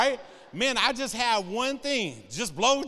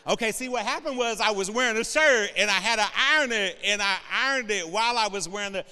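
flustered excuse; 2 semitones higher, 5 dB louder than usual. Retold or imitated. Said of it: "And I'm like".

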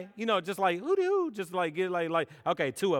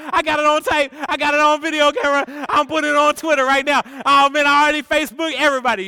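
louder, higher, faster taunting; loud gleeful teasing; 7 semitones higher, 10 dB louder, faster than usual. Acted. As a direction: gloat at the friend rapidly. Teasing friend about crash.

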